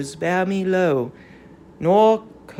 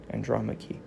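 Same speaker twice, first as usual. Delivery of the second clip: usual speaking voice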